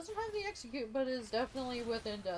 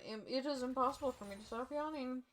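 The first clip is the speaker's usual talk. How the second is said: normal talking voice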